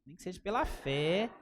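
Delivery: ordinary talk, nothing marked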